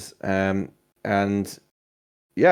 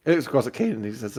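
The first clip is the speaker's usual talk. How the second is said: usual speaking voice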